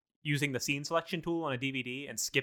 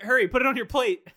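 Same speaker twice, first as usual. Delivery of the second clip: urgent shouting; 10 semitones higher, 7 dB louder than usual. Acted. Urging friend with hot pan.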